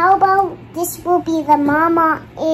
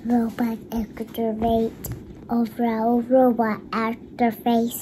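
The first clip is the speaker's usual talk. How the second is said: robot voice; 6 semitones lower, 10 dB quieter than usual. Pretend. Child voicing robot toy.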